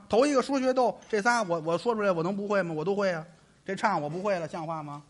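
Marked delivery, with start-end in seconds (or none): none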